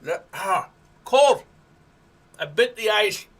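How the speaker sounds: talking around ice in the mouth. Real, not acted. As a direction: mouth full of ice, words muffled. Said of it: "Now I've got ice in my mouth".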